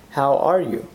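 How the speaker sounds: ordinary delivery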